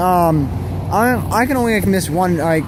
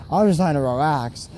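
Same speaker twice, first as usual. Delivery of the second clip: ordinary delivery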